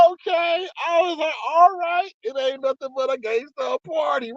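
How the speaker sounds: high, lightly sung delight; high relieved cheer. Retold or imitated. Reacting to the warning, high relieved celebration. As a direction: celebrate getting off.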